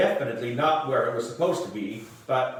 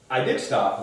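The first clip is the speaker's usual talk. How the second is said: usual speaking voice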